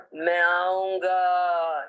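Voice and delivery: very slow, heavy voice, no stammer; slow heavy voice for Main aaunga; heavy possessed voice. Retold or imitated. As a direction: drag out Main aaunga slowly and heavily. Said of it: "She was talking in a very heavy voice".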